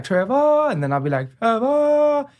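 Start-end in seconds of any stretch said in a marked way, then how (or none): 0.0-0.7 high sing-song summons, end held long; musical mom calling. Retold or imitated. Mom calls out to her son, high musical holler. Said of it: "she'd be like". 1.4-2.3 high sung-out copy of mom's call; mimicking echo. Son mocks his mom's call back to her, high musical echo. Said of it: "It was an echo".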